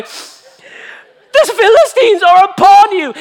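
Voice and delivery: very high, slow British wail; hammed-up British alarm cry at full volume; British accent. Delilah warning Samson of Philistines, loud British accent. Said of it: "I gave her a British accent".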